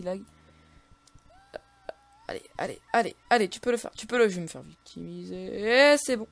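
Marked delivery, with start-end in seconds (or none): 2.3-6.3 loud, building to a stretched-out cry; tense gamer cheering himself on; frantic self-urging. Real, not acted. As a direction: egg yourself on in mid-fight.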